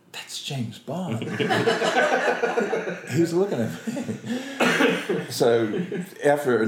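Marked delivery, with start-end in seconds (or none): 0.1-5.3 high, slow, comic inner voice; mock starstruck panic. Actor's brain on seeing James Bond, high mock panic. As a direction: freak out in a high voice, half laughing. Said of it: "my brain's going".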